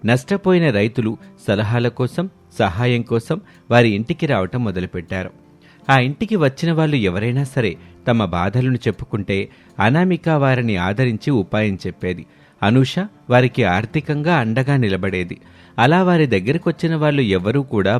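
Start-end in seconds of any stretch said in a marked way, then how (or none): none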